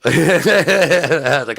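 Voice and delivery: real high, loud laugh into the words. Real, not acted. Retelling a comeback to the driver, laughing. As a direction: burst out laughing, then carry on talking.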